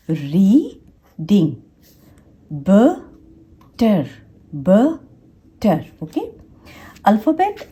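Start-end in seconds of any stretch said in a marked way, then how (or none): 0.1-1.5 reading drawn out as री, then डिंग; long gap between री and ding; syllable by syllable. Shown as a demonstration. Teacher demonstrates two syllables in reading, slowly split. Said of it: "ये भी दो syllable word है". 2.5-5.8 बटर very slowly split into ब and टर; a long pause inside बटर, between ब and टर; syllable by syllable. Shown as a demonstration. Teacher demonstrates two syllables in बटर, slowly split. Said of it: "ये भी दो syllable word है".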